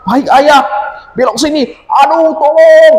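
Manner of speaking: put-on a boy screaming for help in the storm; frightened child crying for help. (anak ketakutan berteriak minta tolong)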